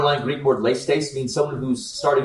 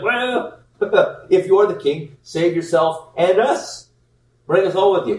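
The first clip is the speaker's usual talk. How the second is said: scornful retold jeer with a laugh, higher, one slow drawn line; mocking taunt; 6 semitones higher, 3 dB louder, slower than usual. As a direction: sneer it like the criminal on the cross. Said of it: "He's mocking Jesus".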